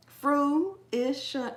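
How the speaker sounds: "Fruition" split into fru, ish, un with gaps; syllable by syllable. Shown as a demonstration. Host showing how she would have to say it, deliberately segmented. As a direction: sound out "Fruition" in careful separate pieces. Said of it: "I would have to say it like that".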